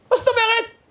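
very high, startled question; imitated flabbergasted father; indignant disbelief. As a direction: squawk the question in mock outrage. Father reacting with outrage.

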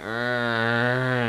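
mock drunk noise; drunken groan. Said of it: "making the general noises".

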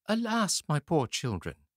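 high, sorrowful woman's voice; pitying woman. A narrator's reading. Ogre's wife warning the lost boys, pitying. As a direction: sigh over the poor children.

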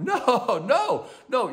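chuckling high, no no no; amused laughing protest. Real, not acted. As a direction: laugh through the denials. Laughing at cookie temptation.

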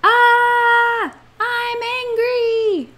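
high, drawn-out mock yell; acted angry yell. Shown as a demonstration. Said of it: "Do you yell".

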